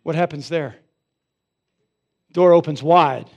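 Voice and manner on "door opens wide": much louder, flung wide; booming reveal; loud dramatic reveal. Real, not acted. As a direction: announce it big.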